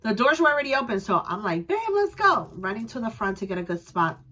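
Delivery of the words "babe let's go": eager call. Retold or imitated. Rushing husband to the front.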